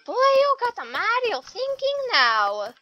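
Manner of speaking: high, loud, cartoonish Mario voice. Gamer hamming a Mario voice at his game. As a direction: chirp it like Mario himself.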